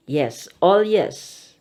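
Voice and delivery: usual speaking voice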